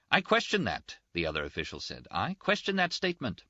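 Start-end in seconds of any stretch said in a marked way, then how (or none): 0.1-1.0 high-pitched official protest; indignant objection. Acted. Official disputing his colleague's claim, high indignant protest. 2.1-3.4 high-pitched official protest; indignant objection. Acted. Official disputing his colleague's claim, high indignant protest.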